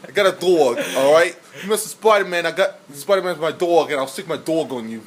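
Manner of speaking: loud, hammed-up New Yorker; New York accent. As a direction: act the mouthy New Yorker, accent laid on thick. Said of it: "You gotta do it for New York".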